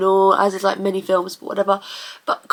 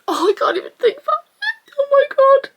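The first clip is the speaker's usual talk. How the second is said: high-pitched tearful wail; overcome, wail it through real tears; crying. Real, not acted. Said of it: "I'm gonna start crying".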